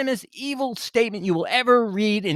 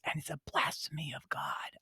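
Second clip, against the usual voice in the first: whisper; 4 semitones lower, 14 dB quieter than usual. Real, not acted. Sudden hushed aside.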